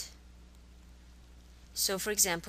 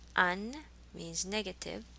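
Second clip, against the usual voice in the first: ordinary talk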